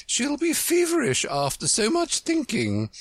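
worried high-pitched queen; anxious. A narrator's reading. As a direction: fret over the patient in a very high voice. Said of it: "anxiously interrupted".

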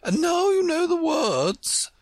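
put-on a high, tired old queen; high queen's voice; sleepy queen. Queen speaking.

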